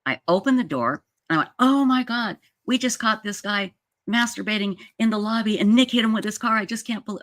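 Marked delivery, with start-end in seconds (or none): 1.6-7.2 retold wreck. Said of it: "I was a wreck".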